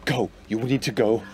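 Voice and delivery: low, softened urging; hushed warning. Acted. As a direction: keep it down while backing away from danger. Acted frightened warning while backing away.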